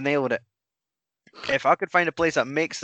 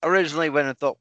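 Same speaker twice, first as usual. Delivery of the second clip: normal talking voice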